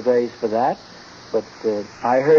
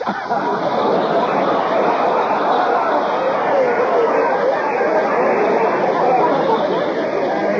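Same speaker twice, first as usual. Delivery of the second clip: big, high burst of laughter at his own joke; howling belly laugh; hearty laugh. Acted. Ozzie cracks up at his pun.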